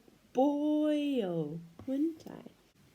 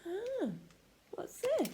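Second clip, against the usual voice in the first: mock surprise; 8 semitones higher, 5 dB quieter than usual. Surprised discovery for children.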